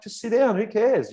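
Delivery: high, breezy brush-off; lightly voiced host telling him not to bother; carefree host. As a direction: wave off his worry. Quoting the hosts, reassuring.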